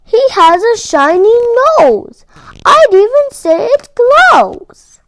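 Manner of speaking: rising to a loud, high, slowed exclamation; astonished father reindeer crying out; surprised cry. Acted. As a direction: blurt it out in shock at the glowing nose. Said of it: "Donner cried".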